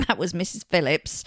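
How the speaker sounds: ordinary delivery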